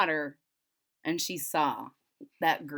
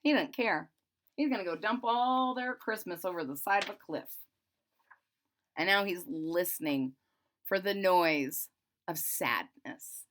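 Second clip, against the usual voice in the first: usual speaking voice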